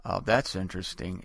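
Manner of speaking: low, unbothered deadpan; deadpan calm Jesus. Retold or imitated. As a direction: answer as a Zen master who feels nothing. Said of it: "he goes".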